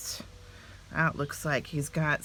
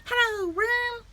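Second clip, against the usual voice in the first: small bird voice; 3 dB louder, slower than usual. A narrator's reading. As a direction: ask like a tiny curious chick. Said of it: "he said".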